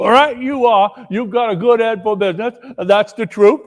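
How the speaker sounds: high, loud, cackling accomplice; gleeful thief. Acted. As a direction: marvel at your partner's nerve. Thief praises partner.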